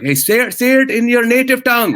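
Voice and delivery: high, loud heckling yell; South Indian heckler. Retold or imitated. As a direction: shout it out like a demanding heckler. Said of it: "I've been heckled by South Indian people".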